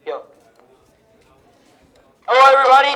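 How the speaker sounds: ordinary delivery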